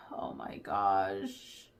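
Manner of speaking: slow, low, soft mutter of dismay; embarrassed blank. Real, not acted. Forgetting a name on camera, slow low dismay. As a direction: drag it out, low, losing the thought.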